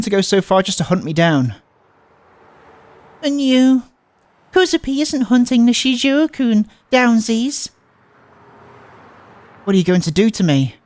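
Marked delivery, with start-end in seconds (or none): 3.2-7.7 put-on high, slow baby-talk girl; sweet, childish, drawn-out reassurance; cutesy girl voice. Transfer student soothing Nishizuo-kun, high cutesy baby talk.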